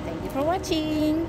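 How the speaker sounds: high, loud, slow thanks; sing-song goodbye to viewers; bright outro, drawn out; cheery sign-off. Real, not acted. Vlog sign-off.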